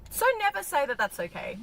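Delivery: ordinary talk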